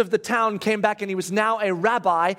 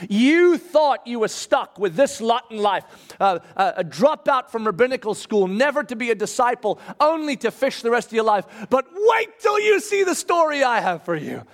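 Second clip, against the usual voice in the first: rousing promise; 4 semitones higher than usual. Retold or imitated. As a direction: build the promise toward a soaring climax. Jesus promises Peter a new story.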